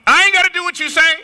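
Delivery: high, fast imitated child's backtalk; sassy little brother. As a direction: talk back like a defiant little kid. Voices young brother talking back.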